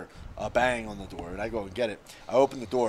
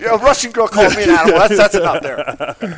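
mock outrage; 7 semitones higher, 12 dB louder than usual. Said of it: "That's where I draw the line".

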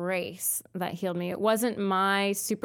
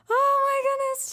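very high, louder overwhelmed cry; retold amazement; 5 dB louder than usual. Daughter whose hearing just came back crying out. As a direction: squeal it in disbelief. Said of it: "I was like".

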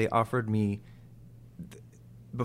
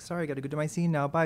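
quick, higher-pitched mimicked farewell; hurried goodbye; 6 semitones higher, faster than usual. Actress dashing off to her scene, imitated in a hurry. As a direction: rush off mid-chat, imitating her.

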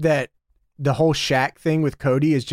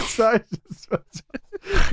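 laughing repetition; 10 semitones higher, much slower than usual. Real, not acted.

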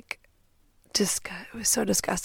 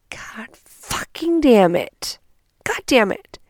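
higher, drawn-out frustrated curse; exasperated cursing; 5 semitones higher, 4 dB louder, slower than usual. Retold or imitated. Woman regretting she did not leave earlier, exasperated. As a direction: curse your rotten luck. Cursing her luck in hindsight.

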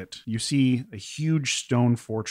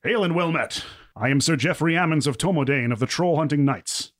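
hearty knight; 5 semitones higher, 4 dB louder than usual. Acted. Knight greets the party.

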